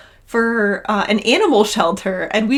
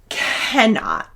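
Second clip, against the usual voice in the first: ordinary talk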